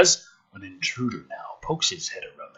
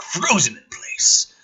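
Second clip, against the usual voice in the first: ordinary talk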